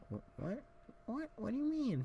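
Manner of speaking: put-on a six-year-old caught off guard, pitch jumping way up; startled high kid voice; shocked squeak. Kid protests at dealer.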